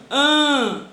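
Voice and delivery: An said loud and high; teacher isolating the An sound; nasal an sound. Shown as a demonstration. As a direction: demonstrate the closed nasal An. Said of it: "vou ter o som de an".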